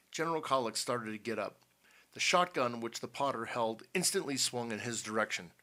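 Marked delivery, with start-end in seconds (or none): none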